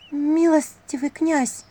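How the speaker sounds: high, slow address to a prince; formal address to prince. A narrator's reading. Timid girl addresses the prince.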